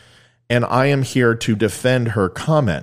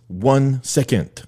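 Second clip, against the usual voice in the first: very slow, higher mock French accent; 4 semitones higher, much slower than usual. French boy answering the teacher's fraction question, rough French accent. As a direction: stretch it out like a rough French impersonation. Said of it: "Sorry about the French accent there".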